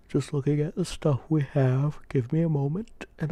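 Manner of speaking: words stretched by a yawn; talking mid-yawn. Real, not acted. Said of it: "I apologize for the yawn".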